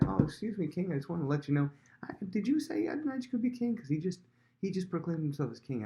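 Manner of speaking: high, fast, faux-innocent interruption; feigned innocence. Nathan to the king, faking innocence, high and quick. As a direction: barge in acting clueless, pitch up. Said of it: "comes in as if he doesn't know".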